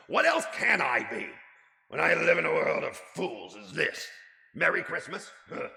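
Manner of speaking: put-on loud, high scornful old miser rant; grumpy rant. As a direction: rail against Christmas bitterly. Scrooge rants at nephew.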